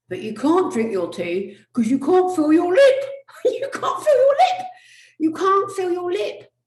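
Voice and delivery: high, loud squeal through laughter; squeaky, helpless laughing wail; hysterical numb-lip mimicry. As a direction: cry with laughter as the lip won't work.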